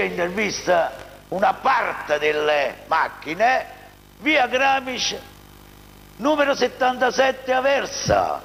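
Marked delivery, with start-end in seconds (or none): 4.2-8.4 infomercial. Acted. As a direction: spell out the address like a commercial. Reads dealer address and phone. (voce da spot, lenta e scandita)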